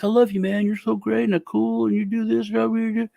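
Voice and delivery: butter him up like an eager fan; mock gushing. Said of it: "they're so fucking like".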